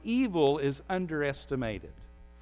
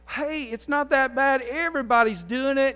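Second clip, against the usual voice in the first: mock peer pressure; 8 semitones higher, 5 dB louder than usual. Shown as a demonstration. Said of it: "and I say".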